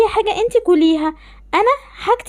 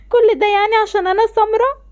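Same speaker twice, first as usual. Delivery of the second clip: ordinary talk, nothing marked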